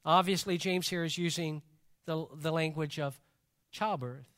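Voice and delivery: ordinary talk, nothing marked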